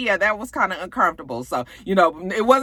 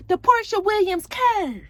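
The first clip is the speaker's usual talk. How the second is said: mock Portia; 13 semitones higher than usual. Portia showing off turning her back on Nene, high mocking voice. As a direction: play a smug, pleased Portia, pitched way up. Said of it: "she was just like".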